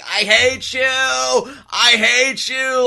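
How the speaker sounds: high, loud mock scream, drawn out on the second. Bride in the ruined cake screaming at Roman, loud high mock scream. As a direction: shriek it like she's just been wrecked. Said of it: "like, screaming".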